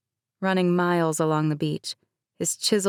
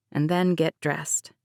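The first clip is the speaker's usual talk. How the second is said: usual speaking voice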